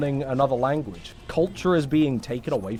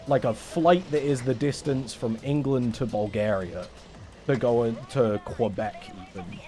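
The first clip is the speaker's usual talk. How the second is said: ordinary talk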